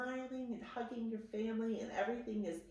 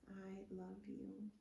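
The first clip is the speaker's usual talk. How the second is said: much softer, slower, tender; 10 dB quieter, slower than usual. Shown as a demonstration. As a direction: let it fall almost to a breath. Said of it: "repeated affirmation, softer repeat".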